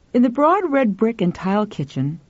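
ordinary delivery

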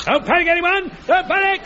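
high, frantic alarm; panicking. Acted. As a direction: shout it in a frenzy of panic. Jones panics over a mine.

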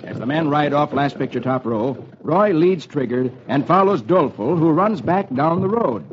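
ordinary delivery, nothing marked

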